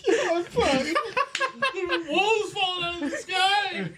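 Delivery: high stunned disbelief; shaken. Acted. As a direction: stare at the sky in shock. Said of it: "She is scarred from this whole endeavor".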